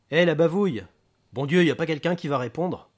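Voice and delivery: exasperated yell for the bodyguard; irritated calling out. Acted. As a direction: holler impatiently for someone to answer. Gangster shouts for staff to answer phone.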